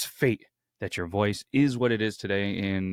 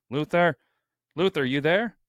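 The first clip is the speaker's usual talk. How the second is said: higher, questioning call; 6 semitones higher, 4 dB louder than usual. Real, not acted. Host calling for his missing co-host, raised questioning call. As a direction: call out to check someone is there.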